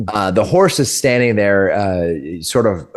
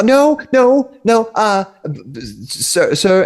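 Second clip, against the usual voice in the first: a high, frantic no, no, no; 10 semitones higher, slower than usual. Acted. The woman panicking as Mixie drills the wheels, frantic. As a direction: beg him to stop in a panic.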